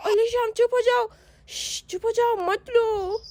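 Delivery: put-on high, tearful child voice; a scared girl, near crying, hiding; young girl's frightened voice, high and teary; frightened little girl. Sonam hushing crying Shyam.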